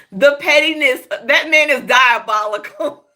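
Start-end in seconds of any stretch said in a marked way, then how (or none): none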